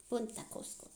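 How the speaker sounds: whispered. Acted. Repeats while writing.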